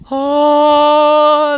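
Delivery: high, loud, drawn-out "oh"; mock awe. Admiring his Nova in the sun, hammed-up awe. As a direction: swell into a grand, sustained exclamation.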